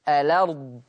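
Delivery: الأرض as "a-lard", no catch after the l; hamza dropped, vowel transferred. Shown as a demonstration. Teacher demonstrating naql, الأرض joined as "alard". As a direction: slide the l straight into the vowel of الأرض. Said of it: "عشان كده تقرأ".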